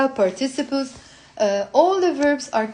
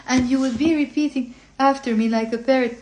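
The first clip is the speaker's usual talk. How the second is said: normal talking voice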